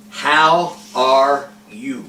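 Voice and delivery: slow, every word drawn out with still lips; ventriloquist practice. Shown as a demonstration. Teacher shows the child slow ventriloquist practice. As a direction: say "How are you?" slowly without moving the lips. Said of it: "Without moving your lips".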